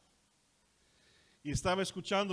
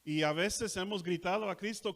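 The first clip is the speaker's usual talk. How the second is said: usual speaking voice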